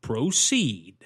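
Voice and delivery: drawn-out Southern gunslinger impression; stretched, unhurried Southern drawl; Doc Holliday. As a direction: imitate a languid movie gunslinger. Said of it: "he goes".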